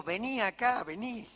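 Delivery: calling the dog with mock menace, laughing; angry threat. Preacher retelling chasing the dog, angry. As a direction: coax then threaten through laughter. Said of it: "Me agarró la ira".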